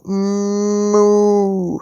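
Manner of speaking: long, low, steady moo; cow moo. Pretend. Parent showing a child the cow's noise, drawn-out moo.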